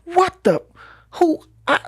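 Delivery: high, stammering mock disbelief; sputtering teen near tears; shocked kid. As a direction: act out a boy gaping at his paycheck. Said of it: "He was like".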